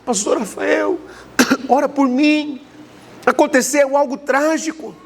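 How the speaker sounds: woman in tears begging the pastor to pray; imitating a sobbing caller. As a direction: mimic a frightened woman crying on the phone. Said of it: "chorando, aos prantos".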